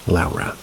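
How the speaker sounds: Laura with the "ow" of Lowry. Shown as a demonstration. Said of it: "I think the last name Lowry".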